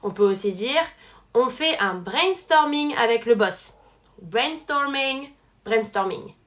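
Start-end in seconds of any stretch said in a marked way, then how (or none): 4.2-5.3 slow, English-accented "Brainstorming"; drawn-out English accent on the word. Shown as a demonstration. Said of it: "C'est le même mot". 5.7-6.3 "brainstorming" frenchified, with a French R; English loanword in French pronunciation; French accent. Shown as a demonstration. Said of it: "mais avec un accent français".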